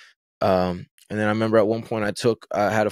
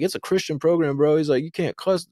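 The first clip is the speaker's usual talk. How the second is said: warning inmate; 7 semitones higher than usual. Retold or imitated. Inmates warning him, voiced.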